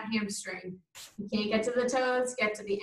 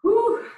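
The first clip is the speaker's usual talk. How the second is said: winded whew; 12 semitones higher, 7 dB louder than usual. Real, not acted. Exhale after ten crunches.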